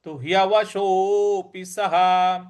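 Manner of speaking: slow demo of the visarga sound. Shown as a demonstration. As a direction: say सः as saha, slowly. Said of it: "विसर्ग ... ह के उच्चारण में ले आएंगे".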